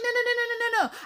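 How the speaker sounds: high, rapid string of no's; emphatic refusal. Retold or imitated. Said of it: "I'm just like".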